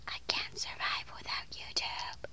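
breathy dramatic whisper. Pretend. Kid whispering to viewers in mock despair. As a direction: whisper it like a tragic confession.

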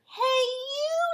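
long, loud, very high holler; big storybook shout; acted calling out. A narrator's reading. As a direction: stretch out the hail brashly. Grouchy ladybug accosts a lobster.

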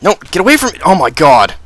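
high loud panicked cry; panicked shout. Real, not acted. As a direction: yell in alarm. Creeper approaching.

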